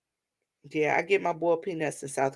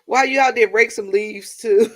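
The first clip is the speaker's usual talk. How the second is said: laughing retelling; 4 semitones higher, 6 dB louder than usual. Retold or imitated. Repeating brother-in-law's request, laughing.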